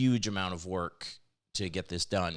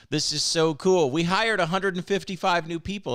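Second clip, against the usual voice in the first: higher, louder, thrilled announcement; excited; 9 semitones higher, 7 dB louder than usual. Real, not acted. Host announcing the new voices to viewers, excited. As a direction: proudly announce it with real excitement. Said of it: "I am so excited about this".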